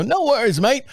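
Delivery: Australian accent impression; Aussie accent. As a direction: do a breezy Australian greeting. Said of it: "you're watching me on the video".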